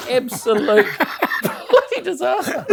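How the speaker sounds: high, slow, laughing through it. Real, not acted. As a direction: crack up on the words. Cracking up at the memory.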